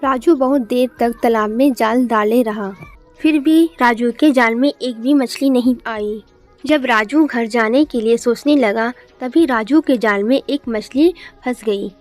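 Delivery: ordinary delivery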